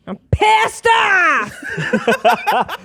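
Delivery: comic full-volume outburst, high and drawn out; mock-furious shout. Guest introducing herself as a murderer, mock-furious shout. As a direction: shout it in over-the-top rage.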